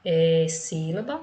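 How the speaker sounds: ordinary delivery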